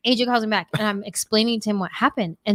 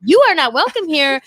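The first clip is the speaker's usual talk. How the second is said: mock rebuke; 6 semitones higher, 3 dB louder than usual. Mock exorcism of the voice.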